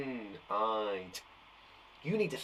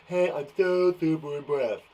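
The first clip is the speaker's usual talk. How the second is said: Trump impression. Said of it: "Sounded a little bit like Trump".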